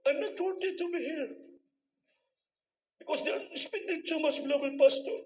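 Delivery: high-pitched imitation of weeping; mock tearful. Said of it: "labas sila, umiyak". (kunwaring umiiyak, mataas ang boses)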